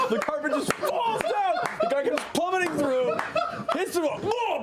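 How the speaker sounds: high-pitched, laughing excitement; excited action narration. Acted. Game master as a guy plummets through the carpet, excited high narration. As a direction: tell the crash in a high, delighted rush.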